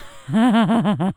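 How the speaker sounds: loud acted cackle; high, loud boozy laughter; drunken laugh. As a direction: burst out laughing mid-sentence. Drunk Sophie breaks into laughter.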